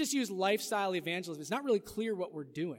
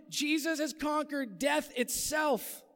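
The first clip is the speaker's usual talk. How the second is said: preaching shout; 7 semitones higher, slower than usual. Real, not acted. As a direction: proclaim it from the pulpit. Preacher proclaims the core message.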